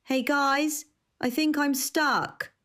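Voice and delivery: high, calling out from a closed room; muffled behind a door. A narrator's reading. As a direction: call through a closet door. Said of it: "Gavin's muffled voice called out".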